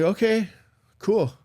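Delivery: high, loud okay; retold acceptance. Young dad accepting the doctors' explanation, high-pitched agreement.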